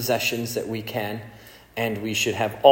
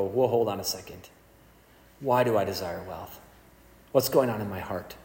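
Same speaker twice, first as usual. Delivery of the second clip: lower, quiet musing aside; soft self-questioning; 6 semitones lower, 8 dB quieter than usual. Pretend. Preacher voicing a chastened listener, quiet and low. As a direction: pull back and wonder to yourself.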